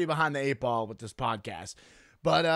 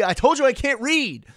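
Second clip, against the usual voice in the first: exasperated outburst; 10 semitones higher, 11 dB louder than usual. Real, not acted. Laughing at own misreading.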